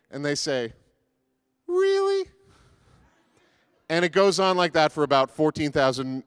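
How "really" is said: very high, incredulous squeak; skeptical really. Acted. Job's friends doubting his denial, skeptical high-pitched. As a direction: question it in a sky-high doubting voice.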